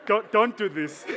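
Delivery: slow, loud, laughing through it; slow laughing self-plea. Retold or imitated. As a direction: beg yourself not to cry while laughing. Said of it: "It's like".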